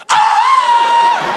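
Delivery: sudden high-pitched loud scream. Pretend. Comic shows how to startle the cop at the window, sudden scream. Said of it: "you just go".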